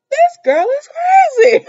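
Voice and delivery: high, loud exclamation breaking into laughter; amazed disbelief. Real, not acted. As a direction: exclaim it high, incredulous and amused. Amazed reaction to Jan's claims.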